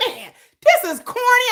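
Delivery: high scoffing dismissal. Retold or imitated. Mocking K-pop fans' fighting skills, high scoff. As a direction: sneer at it from up high. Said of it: "I'm like".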